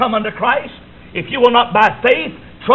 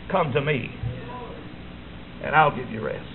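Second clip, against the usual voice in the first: soft tender close; 7 semitones lower, 11 dB quieter than usual. Acted. Preacher to congregation: Jesus offers rest, softly. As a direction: lower and much softer, gentle. Quiet answer after the shout.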